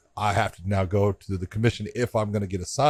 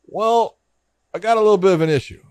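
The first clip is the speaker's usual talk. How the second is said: much higher, hedging imitation; hesitant official; 10 semitones higher, 5 dB louder than usual. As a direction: imitate someone awkwardly breaking bad news, high-pitched. Imitates official breaking awkward news.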